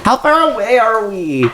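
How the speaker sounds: high, slow drowsy voice; sleepy girlfriend voice. Acted.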